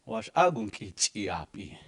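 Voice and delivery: "Wash agunki chiapi" said slowly, each syllable clear; slow Dakota model. Shown as a demonstration. Teacher modelling the phrase for students, slowly. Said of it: "speaking a beautiful language".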